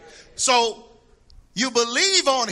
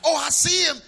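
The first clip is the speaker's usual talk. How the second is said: teasing cousins; 9 semitones higher, 4 dB louder than usual. Retold or imitated. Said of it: "They're like".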